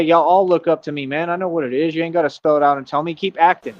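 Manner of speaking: ordinary talk, nothing marked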